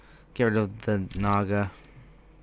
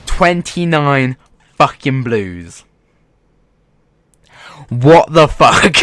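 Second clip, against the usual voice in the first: louder, slowed, drawn-out disbelief; slow exasperated outburst; 3 semitones higher, 10 dB louder, slower than usual. Real, not acted. After twenty-nine blues, shouting slow disbelief. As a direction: let the outrage swell slowly. Outraged at drops.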